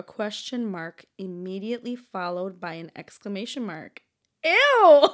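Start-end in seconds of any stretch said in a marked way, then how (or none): 4.4-5.1 squealed disgusted ew; loud laughing yelp of disgust. Real, not acted. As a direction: shriek it in mock disgust. Reacting to interrobang answer.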